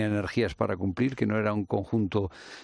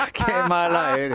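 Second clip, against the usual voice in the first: high, louder, slow, laughing through it; laughing protest; 13 semitones higher, 5 dB louder, slower than usual. Real, not acted. Teasing the interviewer, laughing high and loud. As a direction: tease her back while still laughing.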